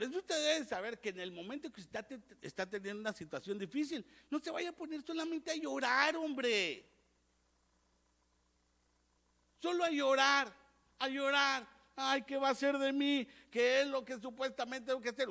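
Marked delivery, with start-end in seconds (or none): 4.3-11.8 mocking crying. Preacher mocking endless crying. 12.0-15.3 mock whiner. Voices a whining believer.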